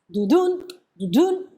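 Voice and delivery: quick "du" jumping onto an accented "dun"; lopsided "du-DUN" rhythm demo; wrongly uneven eighths. Shown as a demonstration. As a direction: rush the first syllable, land on the second. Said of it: "Eso no son dos corcheas".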